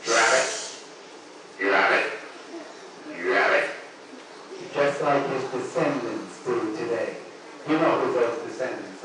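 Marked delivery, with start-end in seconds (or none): 0.1-3.7 low little froglike croaks; tiny creature's croak. Acted. Monster shrunk to fist size, croaking low. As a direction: croak it low, in short repeats. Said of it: "until he was a little tiny creature".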